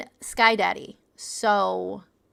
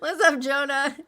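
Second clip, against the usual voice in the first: normal talking voice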